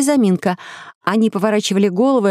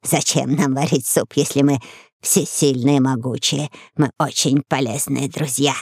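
lizard voice; 5 semitones lower than usual. A narrator's reading.

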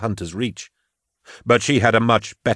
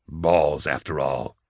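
low grumble, dropping softer; grudging; 5 semitones lower, 4 dB quieter, slower than usual. A narrator's reading. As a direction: give in reluctantly, low-voiced. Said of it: "he said, grudgingly".

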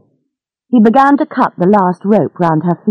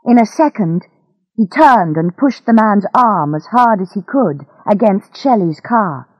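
usual speaking voice

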